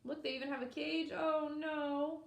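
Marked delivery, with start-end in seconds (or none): none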